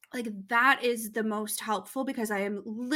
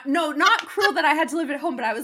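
laughing self-correction; 5 semitones higher, 6 dB louder, faster than usual. Real, not acted. Correcting her own slip to the hosts, a laughing higher rush. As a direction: talk it through a laugh, louder and higher.

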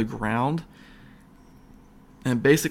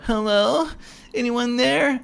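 much higher, sing-song clueless call; naive teenager; 12 semitones higher, slower than usual. Retold or imitated. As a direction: play the dumb horror-film teen. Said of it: "stupid, idiotic teenager that goes down there like".

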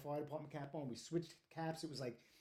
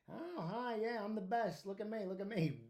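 higher sing-song preening voice; mocking show-off; 5 semitones higher than usual. Distracted fireman showing off to partygoers, mocking preening voice. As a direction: imitate someone vain soaking up attention. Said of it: "A bunch of girls and some guys at a party".

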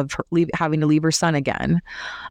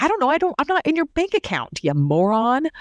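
exasperated mom; 8 semitones higher than usual. Retold or imitated. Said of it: "And she's like".

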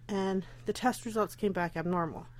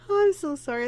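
laughing apology; 6 semitones higher, 6 dB louder than usual. Real, not acted. Apologizing after call interruption.

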